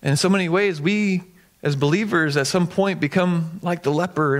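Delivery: usual speaking voice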